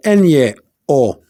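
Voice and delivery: "N-ye-o" drawn out slowly with a y-glide; slow "N-ye-o", the n gliding into ye; changed N sound. Shown as a demonstration. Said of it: "The N is changed".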